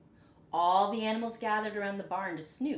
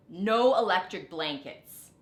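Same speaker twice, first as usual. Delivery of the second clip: firm refusal; 8 dB louder than usual. A narrator's reading. Farmer refuses cows.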